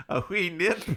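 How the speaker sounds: lightly put-on Scottish brogue; mock Scottish accent. Scottish-accented Irishman inviting Miss Marguerite upstairs, mock Scottish brogue. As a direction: play a Scottish gent in a hammed-up Scottish lilt. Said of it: "he's Scottish instead of Irish".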